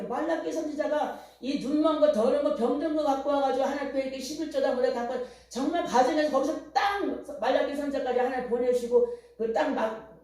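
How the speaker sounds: ordinary delivery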